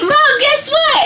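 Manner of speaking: high, loud, drawn-out excited call; excited announcing. Retold or imitated. Daughter with news for mom, excited. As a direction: build up big news at high pitch.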